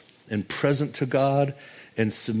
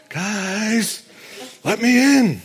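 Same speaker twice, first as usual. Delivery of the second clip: pleading call; 10 semitones higher, 3 dB louder, much slower than usual. Retold or imitated. Peter voiced knocking outside.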